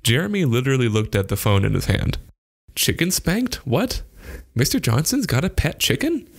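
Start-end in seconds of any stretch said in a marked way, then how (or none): 2.7-6.3 put-on high-pitched, baffled boy's questions; bewildered, squeaked-up questioning; confused. A narrator's reading. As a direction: puzzle it out in rising disbelief. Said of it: "Jeremy was extremely confused".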